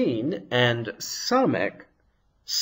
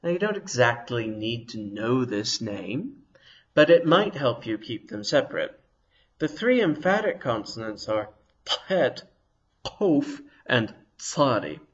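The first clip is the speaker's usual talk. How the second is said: usual speaking voice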